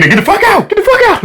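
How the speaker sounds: yelling. Pretend. Shouted eject line.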